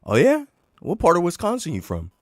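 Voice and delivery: high, loud skeptical retort; mock challenge. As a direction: call his bluff with sarcasm. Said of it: "And I said".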